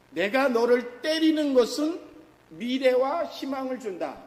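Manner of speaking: 높고 크게 외치는 선포; 온 힘으로 말씀을 외치듯. Acted. (회중에게 하나님의 뜻을 높고 크게 외침)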